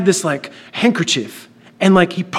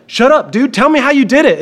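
put-on high, pushy kid pleading; exasperated demand; 6 semitones higher, 3 dB louder than usual. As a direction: demand it with pitch climbing. Boy pesters friend for secret.

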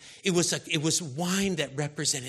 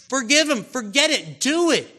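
much higher and louder; much higher, louder preacher's shout; shouted exhortation; 9 semitones higher, 10 dB louder than usual. Real, not acted. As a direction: shout the command.

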